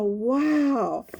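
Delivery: plain reading voice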